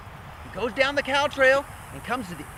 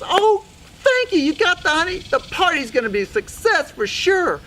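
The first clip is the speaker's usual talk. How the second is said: high, loud, gushing thanks; thrilled gratitude; 8 semitones higher, 6 dB louder than usual. Acted. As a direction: burst out delighted, stretching the opening thanks. Sue Mouse receives the honey.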